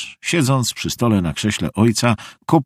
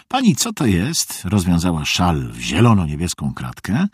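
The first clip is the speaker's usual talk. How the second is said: ordinary delivery, nothing marked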